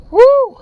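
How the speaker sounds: loud high whoop from cold; cold shock whoop. Real, not acted. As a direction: let out a sharp yelp of cold. Yelps entering cold water.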